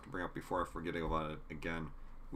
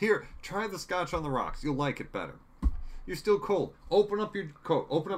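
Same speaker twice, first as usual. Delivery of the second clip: high, loud imitation of a pushy father; fussy parent; 8 semitones higher, 5 dB louder than usual. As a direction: fuss over him, high and insistent. Acting out father fussing over his son.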